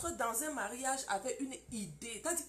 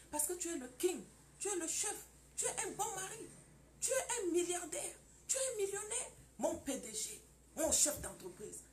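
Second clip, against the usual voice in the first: flattering wife; 8 semitones higher than usual. Acted. Wife flattering her husband.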